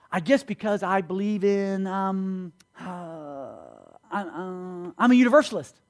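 imitating a halting, unsure man; hesitant answer. Subway stranger explaining his belief to the preacher, hesitant. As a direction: grope through long ums for the answer.

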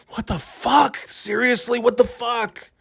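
high-pitched exasperated exclamation; real disbelief. Real, not acted. Host appalled by the intern offer, incredulous. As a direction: voice climbs high in appalled bafflement.

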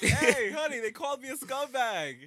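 hammed-up proud Cuomo; mock proud politician. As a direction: crow to your wife like you won a prize.

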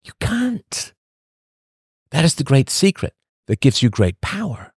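ordinary talk, nothing marked

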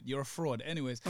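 usual speaking voice

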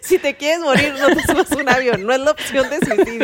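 talking through laughter; words bubbling with laughs; laughing. Real, not acted. Joking while laughing.

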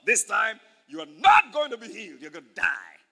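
loud, menacing taunt, dropping at the end; acted devil's threat; Satan. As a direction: threaten like a villain, punching NOT. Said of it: "Satan came and said".

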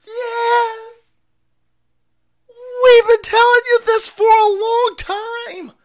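high-pitched, slow mock voice; a mocking cartoonish squeal. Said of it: "A lot of normies are going".

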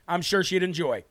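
usual speaking voice

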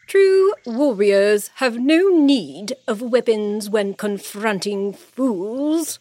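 loud, slow queenly rebuke; sharp words. A narrator's reading. Queen rebuking the king and his soldiers, sharp. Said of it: "the queen's sharp words".

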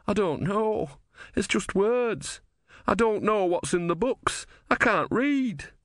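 high, slow, bewildered character voice; distressed novice. Acted. Brother clutches his head before Om, distressed and bewildered. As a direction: plead helplessly, pitched well up.

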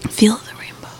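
hushed, airy whispered slogan; ASMR whisper. Pretend. Whispering a slogan to the co-host, ASMR whisper. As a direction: whisper it close into the mic.

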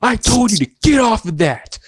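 high, scolding Karen voice; mock angry Karen. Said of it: "And she's like".

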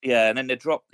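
normal talking voice

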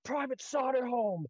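a superior catching someone grinning in ranks; stern master corporal. Retold or imitated. Master Corporal calling out a private in ranks, mock stern. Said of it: "And he goes".

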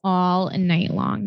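all night long, stretched out slowly; drawn-out emphasis. Real, not acted. Co-host agreeing about hearing fireworks all night, slow drawn-out emphasis. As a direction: draw out each word for emphasis.

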